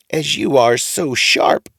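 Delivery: high, drawn-out agreement; odd old man approving the boy; funny old man, slow. A narrator's reading. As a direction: draw it out knowingly.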